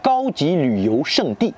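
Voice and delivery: Gaoji Luyou Shengdi said slowly in Mandarin with its tones; Mandarin term. Shown as a demonstration. Teacher giving French viewers the Chinese phrase, slow Mandarin. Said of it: "attraction touristique haut de gamme".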